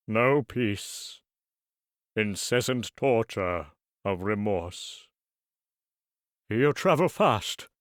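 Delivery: slow, heavy, doom-laden voice; mournful ghost. Acted. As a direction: lament it like a chained spirit. Ghost laments endless travel.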